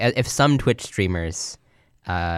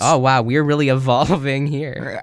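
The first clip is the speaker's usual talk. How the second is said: slow, louder game show reveal; flirty teasing dating host; 4 semitones higher, 4 dB louder, slower than usual. Pretend. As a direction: draw out the name like a dating host.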